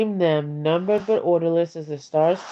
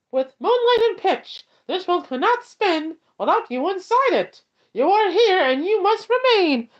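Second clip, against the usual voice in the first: high squeaky cartoon mouse voice, much higher throughout; Mickey Mouse voice; 9 semitones higher than usual. Reading a poem aloud to viewers in a high squeaky Mickey Mouse voice. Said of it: "I will read this one in a Mickey Mouse voice".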